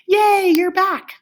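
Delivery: high, loud, drawn-out cheer; excited welcome. Pretend. Teacher welcomes pupils back from a pause, excited cheer.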